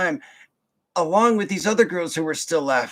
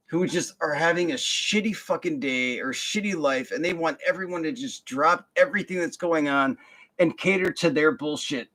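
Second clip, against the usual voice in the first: usual speaking voice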